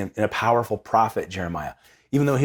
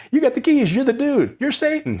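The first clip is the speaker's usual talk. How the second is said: much higher, hammed-up mock quote; 14 semitones higher than usual. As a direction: toss it off high and teasing. Mock retelling of Jesus to Peter.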